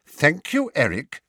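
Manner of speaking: high and loud, firm. A narrator's reading. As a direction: cut him off firmly. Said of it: "said Mister Weasley firmly".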